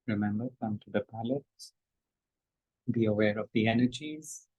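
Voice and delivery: soft, hushed meditation instructions; meditation guidance. Shown as a demonstration. Teacher guiding meditators, softly. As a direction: quietly guide the group inward.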